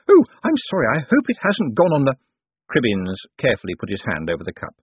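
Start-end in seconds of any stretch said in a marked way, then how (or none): 0.1-2.1 high, raised, flustered apology; intensely anxious. A narrator's reading. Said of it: "The woman's anxious expression intensified".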